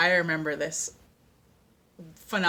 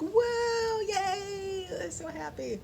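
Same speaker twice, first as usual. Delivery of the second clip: much higher, giddy, fading squeals; ecstatic squeal; 12 semitones higher, 4 dB quieter, much slower than usual. Retold or imitated. Churchgoer during her favorite worship song, high ecstatic squeal. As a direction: gush like pure happiness bursting out.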